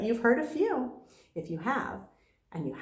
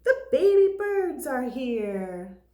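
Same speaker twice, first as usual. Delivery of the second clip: excited; 3 semitones higher, 6 dB louder, slower than usual. A narrator's reading. Hatching reveal.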